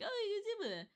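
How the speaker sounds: high-pitched mock whiny quote; mimicking chat. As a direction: imitate the viewers in a high little voice. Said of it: "you guys are like".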